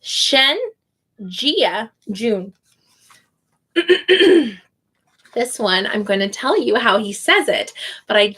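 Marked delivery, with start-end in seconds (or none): 0.0-2.5 "Jiajun" with a long "oo" like June, after a pause from "Shen"; syllable-by-syllable name. Shown as a demonstration. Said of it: "like the month of June".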